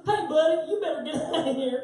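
loud, high, fast Southern holler; exaggerated Southern accent. Guy ordering a drunk away from the house, loud Southern holler. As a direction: ham up the Southern accent at full volume. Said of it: "I just went Southern".

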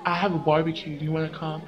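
put-on low, casual boy asking her out; Jordan's invite.

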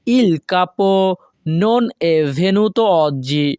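slow, careful Italian model reading; teacher pacing it out; slow model sentence. Shown as a demonstration. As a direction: say it slowly for learners to copy. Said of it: "এর Italian ভাষা হবে".

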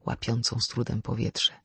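ordinary delivery, nothing marked

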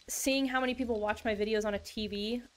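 ordinary talk

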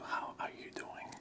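usual speaking voice

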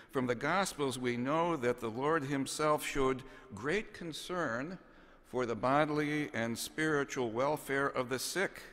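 ordinary talk

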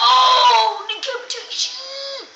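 loud, drawn-out triumphant shout; gleeful exclaim. Real, not acted. Player exults over the decapitation, loud and slow. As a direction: savor the kill word slowly.

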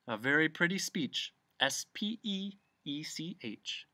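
mild sneer, spelling out letters; sneering bee. A narrator's reading. Bee mocking the humbug's speech, sneering. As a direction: offer mock praise, then spell it. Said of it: "sneered the bee".

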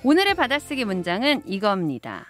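ordinary delivery

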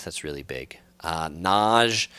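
ordinary delivery

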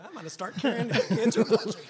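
talking through laughter; amused, laughing as you speak. Real, not acted. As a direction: let real laughter run through the words. Joking along after the advice.

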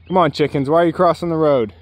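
ordinary talk, nothing marked

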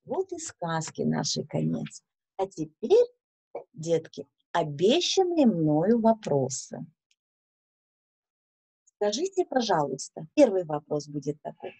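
usual speaking voice

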